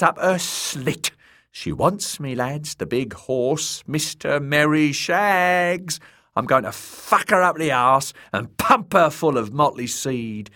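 high, loud lewd jester voice; leering jester. A narrator's reading. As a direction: boast crudely to the lads. Shagwell leering, boasting.

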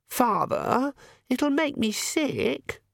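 high-pitched daughter's whine; whining protest. A narrator's reading. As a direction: whine at father, stretched out and high. Daughter protests to her father.

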